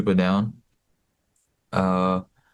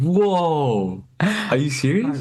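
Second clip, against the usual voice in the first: high, stunned "whoa, are you serious?"; amazed disbelief; 9 semitones higher, much slower than usual. Retold or imitated. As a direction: pitch way up in wide-eyed astonishment, stretched out. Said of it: "And I said".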